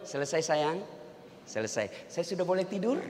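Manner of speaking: soft, timid, asking permission; a tired husband creeping off to bed, hushed; meek quiet husband. Acted. Husband asks nagging wife for permission to sleep, meek and hushed.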